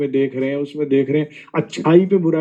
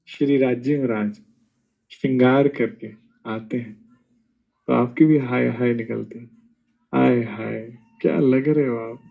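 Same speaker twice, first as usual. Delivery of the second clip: adoring sigh; 5 semitones lower than usual. Retold or imitated. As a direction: sigh it out like a smitten devotee, gently.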